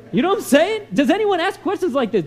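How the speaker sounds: ordinary talk, nothing marked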